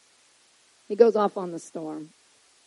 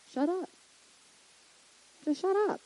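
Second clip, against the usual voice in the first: high soft scolding; 8 semitones higher, 3 dB quieter than usual. Acted. Addresses those causing chaos, hushed.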